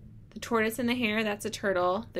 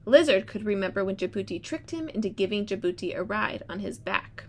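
storybook read-aloud voice; reading to children. Acted. Teacher reads story aloud.

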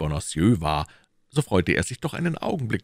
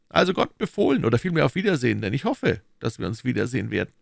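higher, pressing questions as the Cardinal; Cardinal questioning; 7 semitones higher, faster than usual. Acted. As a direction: interrogate his agent coolly. (der Kardinal, verhörend)

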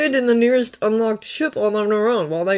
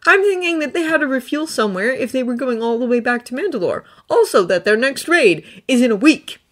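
put-on higher, earnest young Jedi voice; Thonne's voice; 6 semitones higher than usual. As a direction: lift the voice up for Thonne, sincere and pleading. Thonne's plan.

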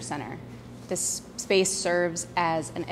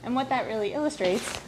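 out of breath; 4 semitones higher than usual. Real, not acted. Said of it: "and I'm out of breath".

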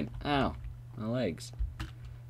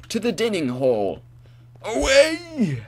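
long, very high and loud shout; hammed-up triumphant yell; heroic battle cry; 10 semitones higher, 8 dB louder, slower than usual. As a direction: launch off like a superhero. Heroic dash off to dining hall.

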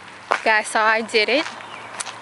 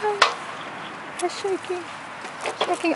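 scared; 8 semitones higher, 7 dB quieter than usual. Real, not acted. Bridge shaking, high up.

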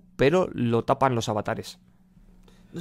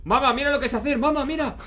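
put-on high, squealing little kid; excited kid voice; 13 semitones higher, 4 dB louder than usual. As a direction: shout it like a proud child. Voicing the kid in the video.

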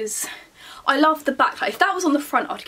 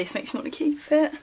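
usual speaking voice

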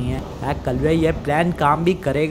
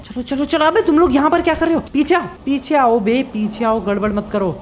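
high, rushed, urgent commands; urgent ordering; 8 semitones higher than usual. Acted. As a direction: herd the friends back in a high-pitched panic. Hurrying friends back out of sight.

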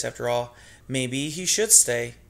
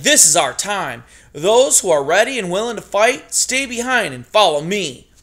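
loud, high, fired-up call; rallying speech; 6 semitones higher, 8 dB louder than usual. Acted. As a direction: rouse them to fight. Ragweed rallies the fleeing mice.

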